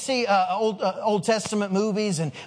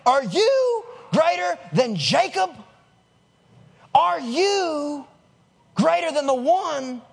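slow, higher-pitched, emphatic question; incredulous challenge; 4 semitones higher, much slower than usual. Acted. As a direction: draw out the challenge in disbelief. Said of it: "She's like".

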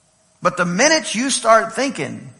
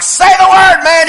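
high, loud exasperated shout; impatient yell; 12 semitones higher, 8 dB louder than usual. Retold or imitated. Dad urging the commercial actor, high impatient yell. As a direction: shout at the screen, hammed-up impatience.

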